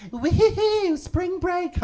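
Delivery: high-pitched party whoop; American teenagers. Retold or imitated. American teenagers on spring break in Mexico, high party whoop. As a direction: whoop it up like American students on holiday. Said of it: "American teenagers being like".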